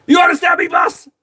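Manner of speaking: high shouted mock challenge. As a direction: yell it like a mock drill sergeant. Mock rough welcome.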